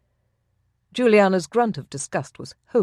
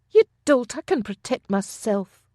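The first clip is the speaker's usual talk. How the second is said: scornful outburst; 6 semitones higher than usual. A narrator's reading. Juliana insults Ronan.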